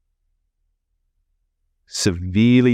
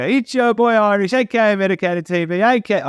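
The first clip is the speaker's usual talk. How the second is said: hype intro voice; 12 semitones higher than usual. Shown as a demonstration. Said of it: "Like, I can go".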